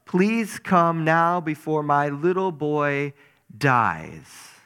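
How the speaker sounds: pleading. Acted. Official begging Jesus to save his little boy, pleading. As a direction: plead urgently, high and loud, then slow down. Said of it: "now he's pleading that he would come".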